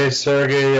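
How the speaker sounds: ordinary delivery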